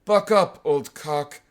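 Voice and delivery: labored hearty call; paralytic professor's exclamation. Acted. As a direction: attempt a buoyant exclamation in an old professor's voice. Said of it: "You should hear me say".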